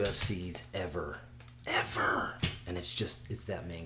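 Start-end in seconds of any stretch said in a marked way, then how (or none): 1.7-2.3 hushed, unvoiced repeat; whispered emphasis. Real, not acted. Fight fan rating Saturday's card, whispered emphasis.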